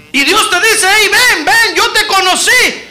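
high, loud, slow preaching; shouting sermon climax; preaching at full force. Acted. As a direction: drive it home to the congregation.

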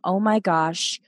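ordinary delivery

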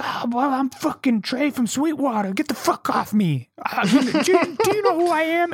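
very high, hammed-up tipsy outrage; imitated drunk protest. Drunk stranger shoved out of the apartment, imitated drunk outrage. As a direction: protest like a wasted guy being manhandled. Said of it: "he's wasted drunk".

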